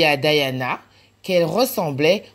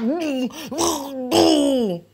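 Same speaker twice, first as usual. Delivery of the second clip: mock boohoo; 7 semitones higher, much slower than usual. Mathieu sulking after falling.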